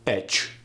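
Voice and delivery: each said as Etch, short flat e; Arabic-accented Etch for each. Shown as a demonstration. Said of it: "words like each and it change to".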